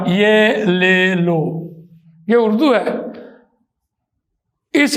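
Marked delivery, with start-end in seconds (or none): 0.1-3.0 یہ لے لو drawn out slowly with Urdu vowels; Urdu-style vowels. Shown as a demonstration. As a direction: stretch یہ لے لو in plain Urdu vowels. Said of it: "او اور اے نکالا تو پھر یہ اردو بن جائے گا". (اردو لہجے میں آہستہ یہ لے لو)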